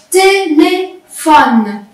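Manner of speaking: « Téléphone » très lent, té-lé-phone; étire « Téléphone » en trois syllabes; syllable by syllable. Shown as a demonstration. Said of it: "Les syllabes, ce sont des sons". (« Téléphone » syllabe par syllabe)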